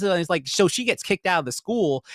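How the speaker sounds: ordinary talk, nothing marked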